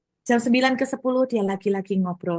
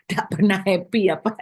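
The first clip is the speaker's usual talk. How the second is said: laughing tease; 4 dB louder than usual. Real, not acted. Laughing teasing remark to listener.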